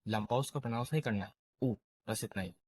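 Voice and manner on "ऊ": ऊ clipped short, not drawn out; short Japanese u, ऊ kept brief; short u sound. Shown as a demonstration. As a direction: say ऊ briefly, no stretching. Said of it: "लेकिन थोड़ा shorter".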